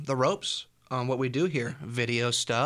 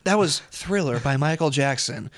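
radio DJ; 4 dB louder than usual. Acted. DJ correction live.

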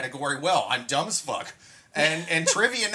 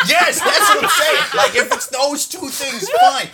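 high, loud laughing yes; laughing excitedly; 11 semitones higher, 8 dB louder than usual. Real, not acted. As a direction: burst out laughing in agreement.

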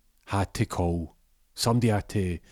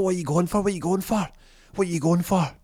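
high, frantic repeated question; panicked yelling; 10 semitones higher, faster than usual. Retold or imitated. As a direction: shriek it in panic, pitch way up. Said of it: "He's like".

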